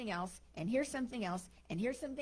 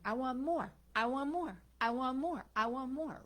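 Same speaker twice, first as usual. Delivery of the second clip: higher, repetitive mock craving; insistent demand; 5 semitones higher, 4 dB louder than usual. Imitating people who always want more, insistent. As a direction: chant it greedily, same tune each time.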